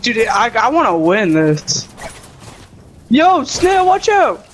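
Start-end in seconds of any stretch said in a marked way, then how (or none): 3.1-4.4 warning shout. Real, not acted. Warning teammate in game.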